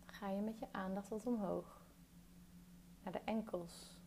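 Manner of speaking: calm meditation guide, long pauses between phrases; guided meditation. Real, not acted. Guiding a listener through a body scan, calm meditation voice.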